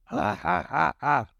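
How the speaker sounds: spaced, deliberate mock laugh; acted villain laugh. A narrator's reading. Krieg gloating over wounded Jin, staged laugh. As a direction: laugh out in separate, staged beats. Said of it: "Krieg lacht los".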